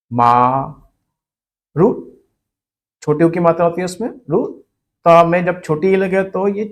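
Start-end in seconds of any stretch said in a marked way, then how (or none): 0.1-1.9 मा and रू as separate syllables, long pause between. Shown as a demonstration. Teacher building a derived word, मा and रू one at a time. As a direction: say मा, pause, then रू. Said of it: "बड़े आ में बदल जाएगा".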